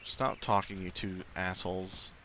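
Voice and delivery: ordinary talk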